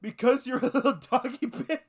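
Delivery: laughing read. Real, not acted. Reading while laughing.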